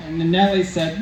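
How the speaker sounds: normal talking voice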